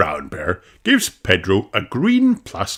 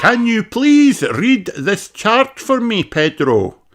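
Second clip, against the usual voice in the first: daddy pony; 12 semitones higher, 4 dB louder, slower than usual. A narrator's reading. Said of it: "says Doctor Pony".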